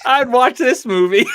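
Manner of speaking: laughing loudly while talking; cracking up mid-sentence. Real, not acted. Laughing at a funny video.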